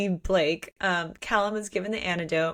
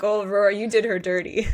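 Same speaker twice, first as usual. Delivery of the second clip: louder teasing call to Rory; mock scolding; 5 dB louder than usual. Podcaster teasing Rory for poisoning Isla, loud mock scolding. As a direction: call out Rory with playful reproach.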